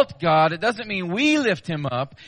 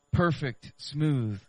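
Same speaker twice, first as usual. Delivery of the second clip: usual speaking voice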